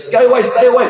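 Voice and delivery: loud, high warning; shouted warning. Acted. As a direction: warn off a crowd of attackers. Acted threat during retold brawl.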